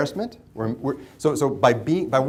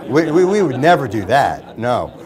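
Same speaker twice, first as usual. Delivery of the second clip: ironic; 3 semitones higher, 11 dB louder than usual. Acted. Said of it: "ironic denial".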